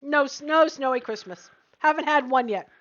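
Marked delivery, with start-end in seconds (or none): none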